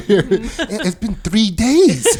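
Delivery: slow words through laughter; laughing at the long wait, slowed down. Real, not acted. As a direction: stretch it out while cracking up. Laughing joke.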